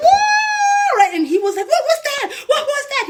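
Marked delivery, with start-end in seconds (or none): 0.1-1.0 very high, loud drawn-out mock scream; imitated scream. Screaming when the bracelets moved, reenacted shriek. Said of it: "I started screaming". 1.7-3.0 very high mock-panicked questioning; imitating scared boyfriend. Boyfriend scared by her screaming, high mock panic. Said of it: "He was, like, so scared".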